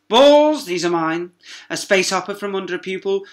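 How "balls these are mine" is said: high, loud barking exclamation; outraged shout. Acted. Teacher confiscates marbles, loud high outrage. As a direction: grab them in a fury.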